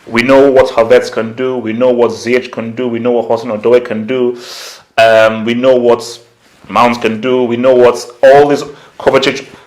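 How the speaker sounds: ordinary talk, nothing marked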